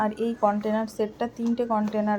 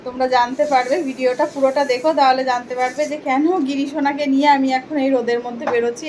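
higher and louder, beaming at the doll; bubbly presenter voice showing off Giri sona; cheery doll talk; 4 semitones higher, 5 dB louder than usual. Acted. Shows off the deity doll. (হাসিখুশি উঁচু গলায়)

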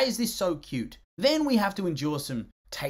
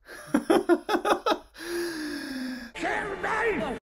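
amused laugh; 12 semitones higher than usual. Real, not acted. Laughing at the dance scene.

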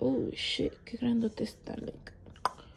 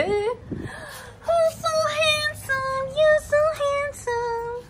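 very high, louder squeal of doting praise; 7 dB louder, much slower than usual. Real, not acted. Gushing baby talk to pet.

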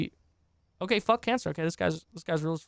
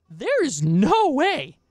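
higher, slow there is no way; disbelief; 8 semitones higher, 4 dB louder, much slower than usual. Real, not acted. Streamer rejecting the apology, slow and disbelieving. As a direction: stretch out the words in disbelief.

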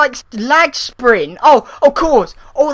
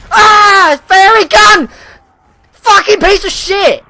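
high, loud outburst of swearing; alarmed yelling; 6 semitones higher, 5 dB louder than usual. Real, not acted. Player attacked by a fairy gun, high alarmed yelling. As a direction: yell out in sudden alarm. Hit by weapon in game.

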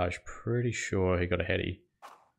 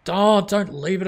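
higher, louder groan of frustration; dismayed cry; 11 semitones higher, 6 dB louder than usual. Real, not acted. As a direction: cry out as the chance slips by. Batter leaves the ball.

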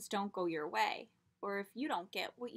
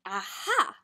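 sudden idea; 10 dB louder than usual. A narrator's reading.

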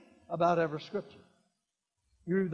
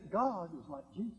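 softer, gentle repeat; quiet restatement; 7 dB quieter than usual. Real, not acted. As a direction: say it again quietly. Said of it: "Can I say it again?".

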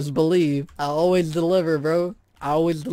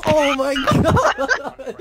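slow, high words broken by laughter; laughing; 5 semitones higher, much slower than usual. Real, not acted. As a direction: crack up mid-exclamation. Cracking up after yelling.

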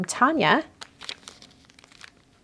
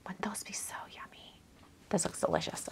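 soft whisper, dreamy over treats; 16 dB quieter than usual. Real, not acted. Admiring the macaron card to viewers, whispered. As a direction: breathe the words as a hushed whisper.

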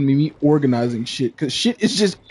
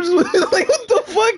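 ordinary talk

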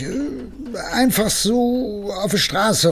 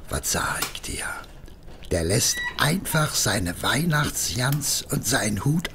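hushed confiding; whispered aside; 6 semitones lower, 8 dB quieter than usual. Acted. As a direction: whisper it like a secret.

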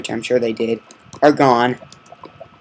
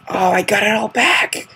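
excited; 8 semitones higher, 3 dB louder than usual. Real, not acted. Recovered all items.

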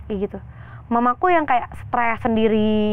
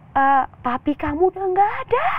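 retold scream; 8 semitones higher than usual. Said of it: "nenekku teriak".

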